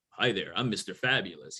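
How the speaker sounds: low, sly creepy greeting; sleazy self-introduction, said low. Retold or imitated. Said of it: "it sounds creepy".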